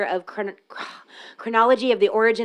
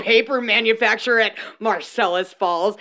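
slow, louder mock-proud reading; sarcastic reading; 5 dB louder than usual. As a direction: read it dripping with sarcasm. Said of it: "I'm gonna read this sarcastically".

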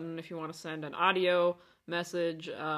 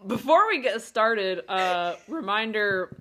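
voice crack; 2 semitones higher, 7 dB louder, slower than usual. Real, not acted. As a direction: voice cracking up mid-phrase. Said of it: "here we go, voice crack".

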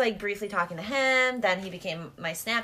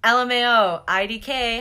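reading out a text; 3 semitones higher, 7 dB louder than usual. Retold or imitated. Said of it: "I said".

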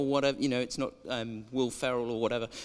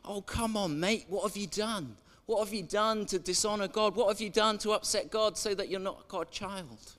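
mocking fellow priests; 6 semitones higher than usual. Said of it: "the other priests in the temple would say".

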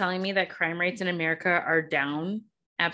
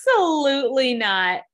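louder, higher outraged "Absolutely not"; emphatic refusal; 6 semitones higher, 5 dB louder than usual. Real, not acted. To the co-host about the memo, an outraged loud no. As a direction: shoot the idea down hard.

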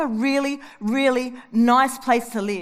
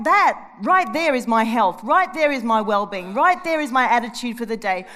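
normal talking voice

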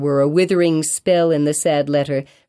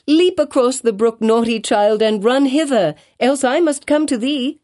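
high, louder call across the brook; raised voice of a scolding mother; 8 semitones higher, 4 dB louder than usual. A narrator's reading. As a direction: call the child over, impatient. Mother calling child across brook.

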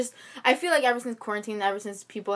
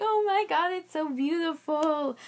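high, slow, breathy wonder; awed gasp; 8 semitones higher, slower than usual. Retold or imitated. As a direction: marvel at the view. Amazed quote of herself outside.

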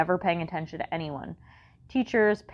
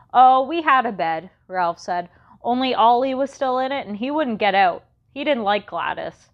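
voiced kid; 5 semitones higher, 5 dB louder than usual. Ralph explains, voiced kid.

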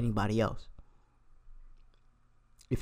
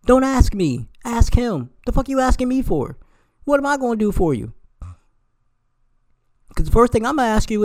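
high, loud and fed up; exasperated rant; 9 semitones higher, 7 dB louder than usual. Real, not acted. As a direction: rant higher and louder, fast. Ranting at women asking for money.

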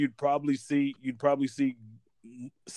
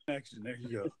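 fading phone line; 11 dB quieter, faster than usual. Real, not acted. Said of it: "you're fading in and out a little bit".

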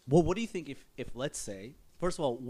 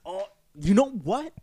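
sudden realization; 8 semitones higher, 6 dB louder than usual. Retold or imitated. Acting out an imagined epiphany.

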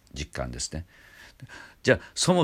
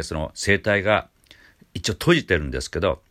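ordinary talk, nothing marked

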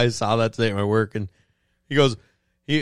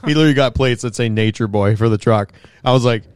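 ordinary talk, nothing marked

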